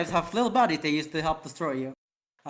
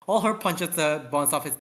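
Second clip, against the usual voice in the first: plain reading voice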